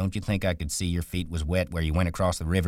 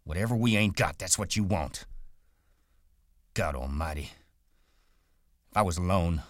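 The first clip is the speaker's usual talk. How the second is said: angry rant; 5 semitones higher than usual. Acted.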